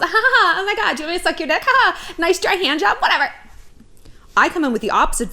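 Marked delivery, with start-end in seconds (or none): 0.0-3.3 high giggling fangirl voice; fawning girl. Retold or imitated. Fawning girl to a hot guy, giggly high gushing. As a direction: gush and giggle to please him. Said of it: "They're used to the fawning girls".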